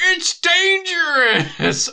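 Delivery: high, loud, slow yell; shouted announcer voice. Retold or imitated. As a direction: bellow it like a cheesy game voice. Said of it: "a guy going".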